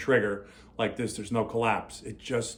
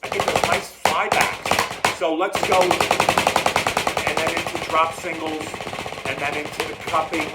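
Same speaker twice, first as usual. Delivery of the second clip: raised voice over the drumming; talking over drums; 4 semitones higher, 4 dB louder, slower than usual. Real, not acted. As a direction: project over your own playing, louder and higher. Talking while demonstrating on drums.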